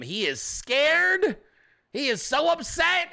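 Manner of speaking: high, drawn-out gloating; gleeful mock triumph. Host voices opponents sure they have him, mock gloating. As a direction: crow it high, stretching out each word. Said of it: "and you're like".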